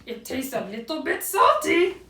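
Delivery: contented storybook owl sampling his tea; happy Owl. A narrator's reading. Owl fills his cup, happy character voice. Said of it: "Owl felt happy".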